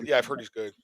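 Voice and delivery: ordinary talk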